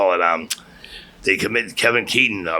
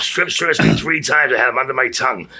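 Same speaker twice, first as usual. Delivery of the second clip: usual speaking voice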